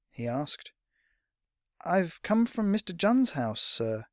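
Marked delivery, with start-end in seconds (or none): 1.8-4.1 put-on higher, slow, tentative boy; hesitant young lad to an elder; somewhat hesitant. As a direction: start politely, a little unsure. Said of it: "somewhat hesitantly".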